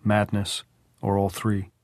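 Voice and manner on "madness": ordinary delivery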